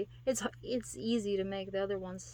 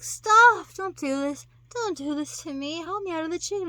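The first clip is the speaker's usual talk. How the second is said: mock pleading woman; 8 semitones higher, 8 dB louder than usual. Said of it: "She's like".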